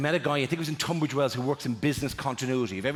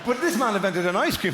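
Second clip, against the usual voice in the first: ordinary delivery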